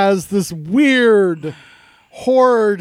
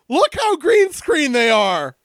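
excited shout; 6 semitones higher, slower than usual. Real, not acted. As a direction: call it out at the screen. Excited outburst at the movie.

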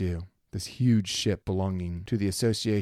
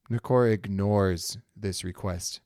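usual speaking voice

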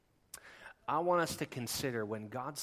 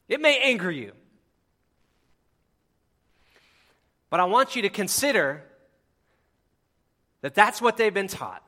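loud, high, fervent preaching; impassioned preaching; 8 semitones higher, 15 dB louder than usual. Acted. Pastor urging his congregation, at full force.

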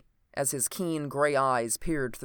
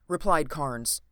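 usual speaking voice

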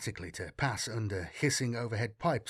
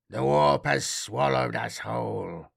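scratchy vox voice. A narrator's reading. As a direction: crackly voice over a radio. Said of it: "The navigator's scratchy voice rattled over the vox".

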